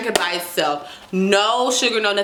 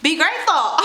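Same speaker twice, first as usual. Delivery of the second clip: mock sass; 7 semitones higher than usual. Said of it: "I'm playing".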